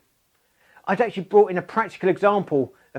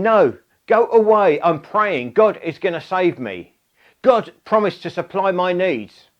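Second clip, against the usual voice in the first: exasperated shout; 3 semitones higher, 4 dB louder than usual. Retold or imitated. Dave yells at the rescue helicopter to leave, fed-up shout. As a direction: drag out the refusal with fed-up force. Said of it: "Dave's saying".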